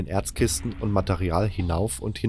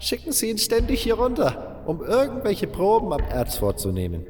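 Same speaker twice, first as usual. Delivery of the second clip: much higher old-man voice, mocking; old miner gossiping; 8 semitones higher than usual. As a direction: sneer at the misfits, praise only the boss. Old butler gossips about mine.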